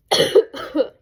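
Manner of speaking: sudden chesty cough. Real, not acted. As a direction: cough once into the mic. Speaker has a cold.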